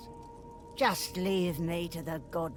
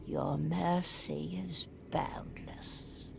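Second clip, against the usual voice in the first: whispering; 2 semitones lower, 5 dB quieter than usual. Acted. Ghost whispers gratitude.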